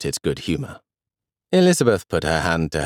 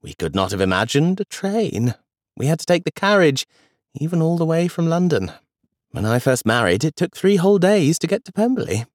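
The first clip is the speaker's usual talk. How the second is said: lightly raised, amused granny voice; a hint of grandmother Elizabeth; 8 semitones higher than usual. A narrator's reading. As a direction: play the fond grandmother lightly. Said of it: "she said".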